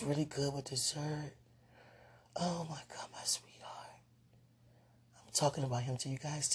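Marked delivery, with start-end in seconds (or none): 2.4-4.0 breathy whisper to herself; hushed excited whisper; whispered aside. Real, not acted. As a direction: whisper it like a secret. Whispering aside about boyfriend.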